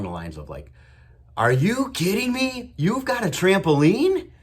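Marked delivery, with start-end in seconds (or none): 1.4-4.3 much higher, louder, slow goofy amazement; goofy loud excitement. Retold or imitated. Dad hyping up his moody daughter in the car, loud goofy disbelief. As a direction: play it up like a silly excited dad. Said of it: "I was saying it real loud, real goofy".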